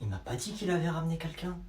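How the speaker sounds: low, hushed murmur; puzzled aside. Acted. Eavesdropper puzzled by the boy's chatter, low hushed aside. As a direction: wonder to yourself unheard.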